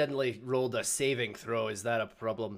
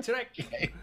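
ordinary delivery

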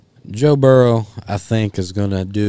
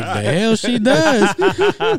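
very high, slow, laughing disbelief; hammed-up indignant husband through laughter; mock outraged husband; 5 dB louder, much slower than usual. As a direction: stretch it out high, cracking up. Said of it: "He's like".

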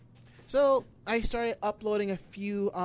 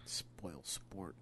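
whispered, much softer; 12 semitones lower, 18 dB quieter than usual. Real, not acted. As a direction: mutter it under your breath. Teasing under breath.

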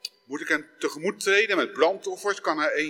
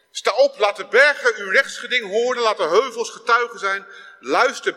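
loud, raised reading of the prophet's words; scripture reading; 2 semitones higher, 5 dB louder than usual. Acted. Preacher reading Micha to the church, loud and proclaiming. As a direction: proclaim the text to the congregation.

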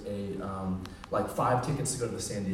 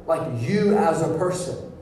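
raised, emphatic sermon delivery; impassioned preaching; 5 semitones higher, 6 dB louder than usual. Real, not acted. Preacher telling listeners God is about them, impassioned. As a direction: drive it home to each listener.

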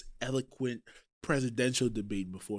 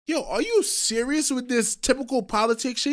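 higher-pitched mock incredulity; retold disgust; 8 semitones higher, 4 dB louder than usual. Host retells Trump reacting to Biden in mock disgust. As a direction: challenge him in lightly acted disgust. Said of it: "looking at him in disgust".

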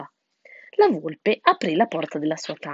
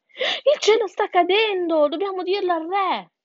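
high, urgent squeal; panicked animals; 11 semitones higher, 3 dB louder than usual. A narrator's reading. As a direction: blurt the alarm to the fox. Animals raise alarm to fox. (animali allarmati, voce acuta)

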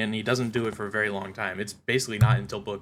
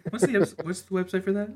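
higher-pitched mock-clueless question; clueless Scott; 10 semitones higher than usual. Scott asking his roommate about a website, mock clueless. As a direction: lightly act out a clueless asker. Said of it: "He's like, he's like".

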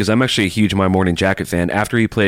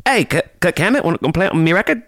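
mock Australian accent; 10 semitones higher, faster than usual. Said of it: "There we go".

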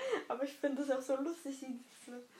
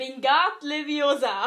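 loud, slow and drawn-out spell; spell incantation; 12 dB louder, slower than usual. Acted. Player performing a famous spell for the quiz, loud incantation. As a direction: cast the spell with full force.

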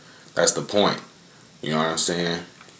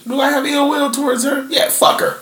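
very high squeaky mock voice, airy at the end; mock falsetto. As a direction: squeak out the petty answer as a joke. Mock answer about ex-girlfriend.

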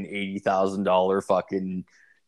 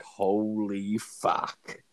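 very slow, softer drawn-out disbelief; stunned awe; 5 dB quieter, much slower than usual. Retold or imitated. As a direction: let it sink in, stretched and hushed. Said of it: "I was like".

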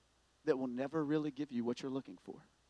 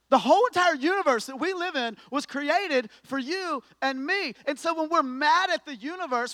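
loud, higher, driving preacher voice; preaching at full force; 8 semitones higher, 10 dB louder than usual. Acted. Preacher mocking complaints against the universe, loud. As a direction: preach it out with fire.

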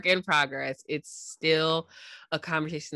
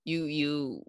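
ordinary delivery

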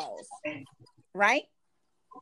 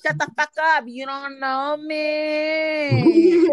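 loud, slow, dragged-out mock rage; mock furious yell; 3 semitones higher, 5 dB louder, much slower than usual. Lady boy yelling at the comedian, dragged-out mock rage. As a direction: stretch it out, outraged.

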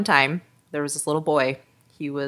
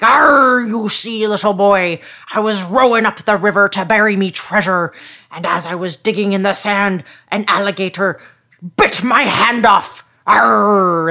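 pirate; 4 semitones higher, 7 dB louder than usual. Acted. Pirate telling little boy how he lost his hand, hearty. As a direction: boom it out like an old sea-dog. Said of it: "And the pirate's like".

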